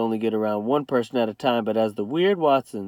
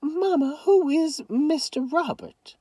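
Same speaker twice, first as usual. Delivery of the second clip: high, worried question; much higher worried daughter voice; 3 dB quieter than usual. A narrator's reading. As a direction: ask anxiously. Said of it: "Mama sounded worried".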